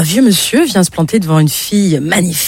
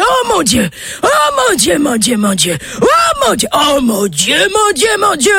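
high, wailing cries; yelling; 10 semitones higher than usual. Acted. Old man groping the woman, wailing high cries. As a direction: cry out over and over in shock. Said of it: "il se met à hurler".

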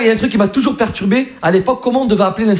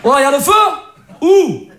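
high, raised-voice calls down the line; hollered questions; 10 semitones higher than usual. Acted. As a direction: holler it in short surprised bursts. Acted Marseille phone call about fire.

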